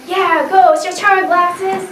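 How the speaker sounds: high, loud urging; group egging on. Retold or imitated. Classmates push the girl to dance, high and loud. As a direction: cheer her into the circle.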